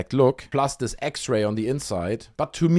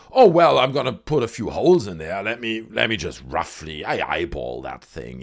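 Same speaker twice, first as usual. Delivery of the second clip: offhand, dropping much lower by the end; careless faker; 4 semitones lower than usual. Vlogger voices sloppy hoaxers drilling holes, mock careless. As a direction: toss it off like a lazy hoaxer. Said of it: "you can't be like".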